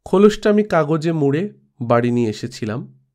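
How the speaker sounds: ordinary delivery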